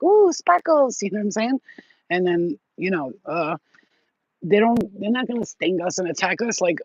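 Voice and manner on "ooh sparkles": high squeal of delight; delighted bees. Pretend. Bees spotting sparkles, high delighted squeal. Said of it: "They're like".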